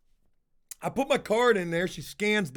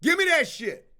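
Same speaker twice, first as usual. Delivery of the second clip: high, pushed demand; mock demand; 8 semitones higher, 3 dB louder than usual. Retelling how he demanded his money back, hammed-up loud. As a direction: bark it like a hammed-up stick-up.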